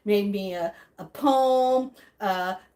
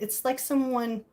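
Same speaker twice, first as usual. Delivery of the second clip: normal talking voice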